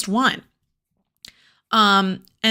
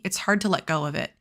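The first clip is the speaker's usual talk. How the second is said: plain reading voice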